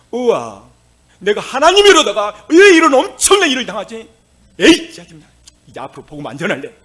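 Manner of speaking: loud, high, exasperated griping; imagined complaint. Pretend. Preacher mimicking Paul and Silas grumbling in jail, loud and fed up. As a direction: whine like fed-up apostles. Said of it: "말합니다".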